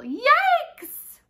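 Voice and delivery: very high, loud yikes; shocked yelp. A narrator's reading. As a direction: squeal it in fright. Sees whale shadow.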